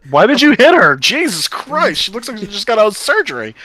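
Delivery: high-pitched, loud mock outrage; hammed-up indignant protest; indignant outcry. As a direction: scold them in appalled disbelief. Acted engineer scolding the humans.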